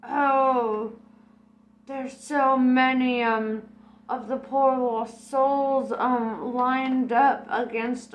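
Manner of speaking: hammed-up spirit guide; Geronimo voice.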